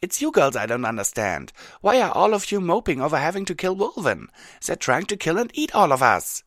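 loud, high, heated challenge; exasperated Rainbow Dash. Acted.

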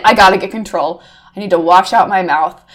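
ordinary delivery

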